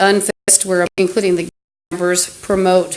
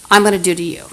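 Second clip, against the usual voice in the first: ordinary talk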